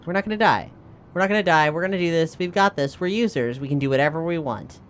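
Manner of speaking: normal talking voice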